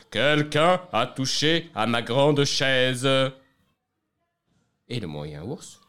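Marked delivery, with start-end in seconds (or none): 0.1-3.1 loud, slow, booming bear voice; big bear's big voice. Acted. The big bear discovers someone touched his chair, booming. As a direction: boom it out like a huge angry bear. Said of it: "cria d'une grande voix".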